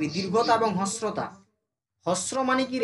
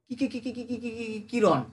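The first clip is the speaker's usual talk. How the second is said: mimicked film-hero stammer, softer repeated "কি"; Shah Rukh Khan; 3 dB quieter than usual. Teacher mimicking a film line for the class, stammered impression. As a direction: stutter "কি" over and over, quietly. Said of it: "Shahrukh খানের কিরণ".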